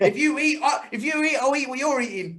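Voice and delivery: mock Briggs taunt. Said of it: "Briggs quoted in restaurant".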